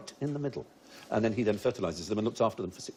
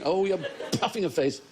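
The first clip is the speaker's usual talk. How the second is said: ordinary talk, nothing marked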